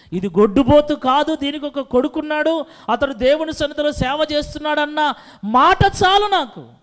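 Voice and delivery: lightly voiced, rising pleading prayer; pleading Hannah. Preacher voices Hannah begging God, loud pleading. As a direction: beg God for a son.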